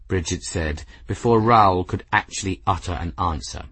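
ordinary delivery

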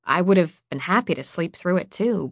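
plain reading voice

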